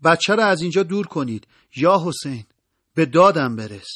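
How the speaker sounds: high, loud plea for help; narrated cry out. A narrator's reading. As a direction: cry out urgently to those nearby. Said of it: "فریاد کشید".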